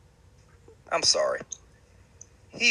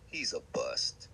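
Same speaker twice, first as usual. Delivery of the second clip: deadpan; 12 semitones lower, 12 dB quieter, slower than usual. Real, not acted. Final verdict after many no's.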